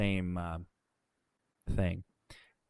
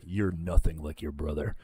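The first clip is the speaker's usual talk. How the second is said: low, mocking impression of a petty supervisor; annoying trainer voice; 7 semitones lower, 4 dB quieter than usual. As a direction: imitate an annoying guy, pitched down. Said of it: "he had the most annoying fucking voice too".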